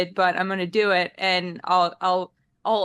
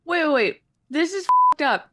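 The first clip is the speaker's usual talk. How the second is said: louder, higher protest of alarm; alarmed outburst; 6 semitones higher, 4 dB louder than usual. Real, not acted. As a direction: stop everything, this is outrageous. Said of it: "Excuse my French".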